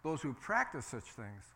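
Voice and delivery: ordinary delivery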